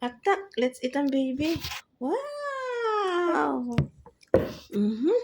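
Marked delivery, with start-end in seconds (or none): 2.0-3.8 high, long drawn-out wow; high admiring exclamation; amazed. Real, not acted. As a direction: stretch it out in delighted wonder. Parent admires the food.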